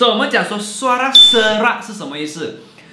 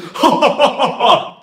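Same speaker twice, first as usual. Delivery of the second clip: high laugh; 6 semitones higher, 4 dB louder than usual. Real, not acted. Laughs after his loud demo.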